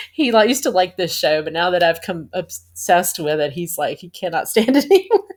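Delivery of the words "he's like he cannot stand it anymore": ordinary talk, nothing marked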